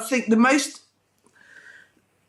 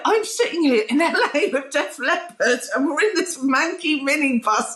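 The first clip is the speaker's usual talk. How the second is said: higher-pitched, laughing through the words; cracking up laughing; 4 semitones higher than usual. Retold or imitated. Telling friends she was lost in a minibus with Def Leppard, cracking up. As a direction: retell it while cracking up. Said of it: "and just cracking up laughing".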